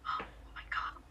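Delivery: breath-only awed gasp; whispered awe. Retold or imitated. Friends watching the hand grab, imitated in an awed whisper. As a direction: whisper it as if stunned.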